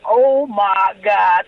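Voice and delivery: drawn-out, low awe; stunned, slow. Retold or imitated. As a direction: let it sink in slowly. Just hired by [name].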